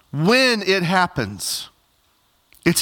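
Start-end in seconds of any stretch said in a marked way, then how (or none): 0.1-1.7 "When" drawn out long and pitched high; slow, emphatic "When", held before the rest; stress on WHEN. Shown as a demonstration. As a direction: lean slowly and firmly on "When". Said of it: "I want to emphasize that word when".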